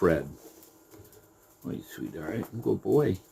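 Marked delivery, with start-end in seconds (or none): 1.7-3.2 much softer, cooing praise; soft pet talk. Real, not acted. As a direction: soothe the rabbit while holding it. Cuddling rabbit on camera.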